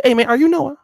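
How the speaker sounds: put-on high, eager kid voice; a nosy kid calling out; little Percy. Little Percy greeting Noah, pitched high. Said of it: "He said".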